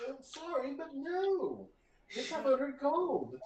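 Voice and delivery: receptionist voice. Acted. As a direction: turn him down sweetly but firmly. GM voicing receptionist refusing.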